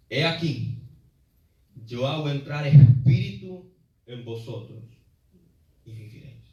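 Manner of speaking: slow, drawn-out proclamation; solemn scripture reading. Acted. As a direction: intone each phrase with long pauses. Reads God's words slowly.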